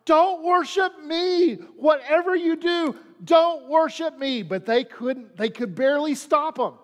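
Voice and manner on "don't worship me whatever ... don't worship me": high, drawn-out, urgent protest; crying out. Acted. Paul refuses the crowd's worship, crying out in protest. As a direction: cry out to stop them, pitched well up. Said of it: "and he cries out".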